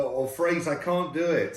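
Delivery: normal talking voice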